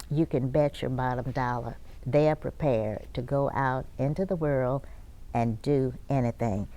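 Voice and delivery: normal talking voice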